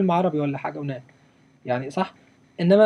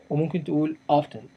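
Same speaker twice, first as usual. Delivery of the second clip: ordinary talk, nothing marked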